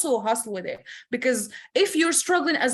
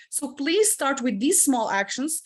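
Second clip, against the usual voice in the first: ordinary talk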